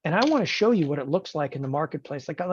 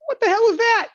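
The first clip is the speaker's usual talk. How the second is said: high, loud horrified yelp; shocked mechanic; 7 dB louder than usual. Retold or imitated. Body shop mechanic sees the wrecked car, loud high shocked cry. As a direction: gasp at the wreck in disbelief. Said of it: "they're gonna go".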